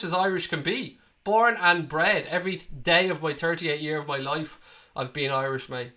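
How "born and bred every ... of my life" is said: ordinary delivery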